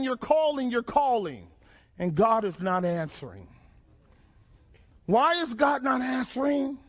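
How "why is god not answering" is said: ordinary talk, nothing marked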